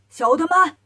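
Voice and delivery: calling out to underlings. A narrator's reading. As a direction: summon your minions loudly. Lion demon calls his underlings. (高声吆喝小妖)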